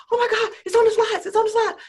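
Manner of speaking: high, loud, fast near-crying panic; panicked wail. Retold or imitated. Speaker panics to husband when the slides won't work, high near-crying wail. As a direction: wail it fast as if the show is collapsing.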